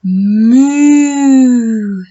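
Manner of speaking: loud long moo, lips together; sustained mooing for children; cow moo. Shown as a demonstration. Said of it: "keep those lips together".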